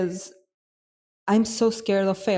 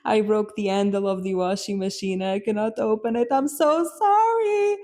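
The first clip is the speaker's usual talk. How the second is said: pleading apology; 3 semitones higher than usual. Retold or imitated. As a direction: act out a hammed-up, pleading confession. Acts out confessing to her roommates.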